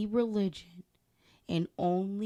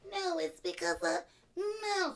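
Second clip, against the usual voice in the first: imitating friend; 11 semitones higher, 5 dB quieter, slower than usual. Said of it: "but you wanted to, like".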